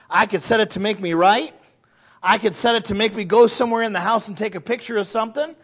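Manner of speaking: usual speaking voice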